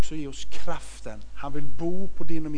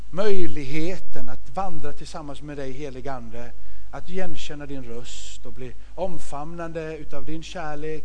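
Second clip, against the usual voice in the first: prayer; 4 semitones lower than usual. Acted. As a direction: pray gently with the congregation. Leder bön.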